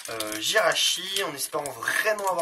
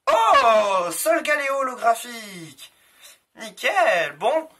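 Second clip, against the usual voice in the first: excited; 7 semitones higher, 8 dB louder, much slower than usual. Real, not acted. Rare holo card revealed.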